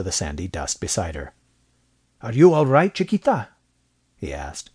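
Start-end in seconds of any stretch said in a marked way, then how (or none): 2.2-3.5 higher, louder worried question; Spanish-accented man checking anxiously on someone; concerned. A narrator's reading. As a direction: ask with urgent concern for the hurt girl. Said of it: "obviously concerned".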